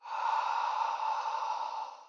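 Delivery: long hushed open-mouth haa; ujjayi breath. Shown as a demonstration. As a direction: breathe out like fogging a mirror. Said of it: "breathed upon it with sound".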